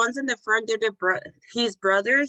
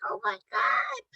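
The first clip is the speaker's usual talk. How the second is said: high, breathy awed gasp; reacting to the crying grandma; moved gasp; 13 semitones higher, 4 dB quieter, slower than usual. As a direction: gasp softly in awe. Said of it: "and I was like".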